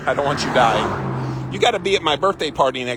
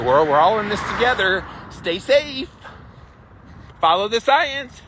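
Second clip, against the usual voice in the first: mock cliché; 8 semitones higher than usual. Mocking a string of clichés.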